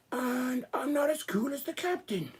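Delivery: high whispered mock British voice; whispered British doll. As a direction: breathe it out as the shy British doll. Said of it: "I'm Samuel".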